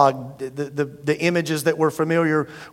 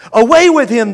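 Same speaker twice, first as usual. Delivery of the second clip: higher, louder yell; yelling crowd; 6 semitones higher, 8 dB louder than usual. Acted. The crowd rejecting Jesus, loud yell. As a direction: throw him out with your voice. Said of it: "they yelled".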